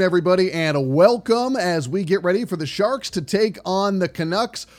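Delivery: ordinary talk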